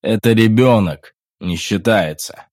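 disappointed. A narrator's reading. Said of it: "огорчённый Генри".

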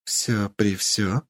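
slow, soft and teasing; coy and playful, drawn out; flirtatious. Acted. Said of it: "кокетливо спросила она".

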